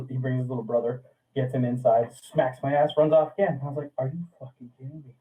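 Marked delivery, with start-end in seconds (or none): 4.0-5.1 soft, fast muttered disbelief. Retold or imitated. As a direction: mutter it fast under your breath.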